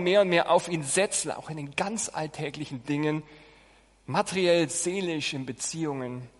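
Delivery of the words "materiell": ordinary delivery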